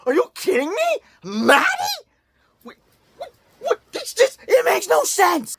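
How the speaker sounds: very high stunned squeal; can't believe it, pitch flies up; shocked disbelief. Acted. Reviewer stunned by the Maddie scene, very high disbelief.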